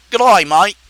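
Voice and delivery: fuller, punchier Australian greeting; Australian accent. Acted. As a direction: call it out in a broad Aussie accent. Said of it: "That's all I can say in Australian".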